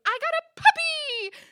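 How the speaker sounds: very high, loud excited squeal; gleeful announcement. Retold or imitated. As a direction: imitate an overexcited boyfriend, shouting it high.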